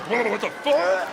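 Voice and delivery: a startled mock outburst; shocked whoa. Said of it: "you're like".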